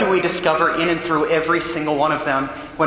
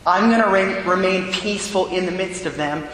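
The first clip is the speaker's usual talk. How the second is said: raised, urgent preaching voice; fervent preaching; 5 semitones higher than usual. Acted. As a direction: preach it with rising fervor.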